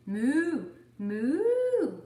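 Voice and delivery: high, loud sing-song moo; playful mooing. Acted. Cow diving into the pond, high loud moo. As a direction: moo it as you dive.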